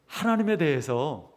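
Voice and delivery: normal talking voice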